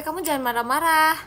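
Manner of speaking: normal talking voice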